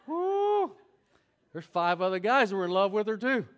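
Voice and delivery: loud, high gleeful whoop over the crowd; mock whoop. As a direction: cheer it out like a relieved man. Joking whoop about old girlfriend.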